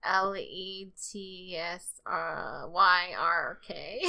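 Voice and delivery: letters read backwards very slowly; backwards spelling. Shown as a demonstration. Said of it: "Now backwards?".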